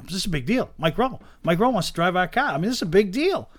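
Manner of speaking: lightly voiced excited pleading; pleading excitement. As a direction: hype it up to the wife. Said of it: "I go".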